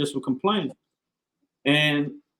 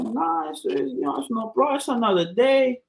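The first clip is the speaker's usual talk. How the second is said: easygoing sailor voice; 8 semitones higher than usual. Retold or imitated.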